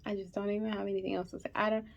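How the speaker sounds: fighting not to cry; quiet, tearful words; holding back tears. Real, not acted. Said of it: "I'm trying so hard not to cry".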